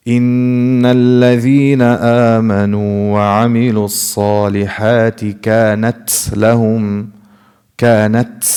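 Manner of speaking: recited, with كانت ending in a hissed TS; wrong seen sound. Shown as a demonstration. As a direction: chant it, adding S after ت in كانت. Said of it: "This is incorrect".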